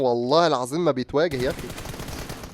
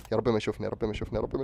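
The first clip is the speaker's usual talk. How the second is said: hushed prayer; 5 semitones lower, 10 dB quieter, much faster than usual. Real, not acted. Hiding, praying not to be seen.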